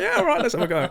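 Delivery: high, loud, laughing burst of eager agreement; giddy laughing yes. Retold or imitated. After a Stella, finally agreeing to grab the mic: giddy laughing yes. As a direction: blurt it out with a tipsy laugh, suddenly keen.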